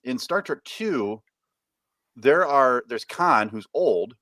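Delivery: ordinary delivery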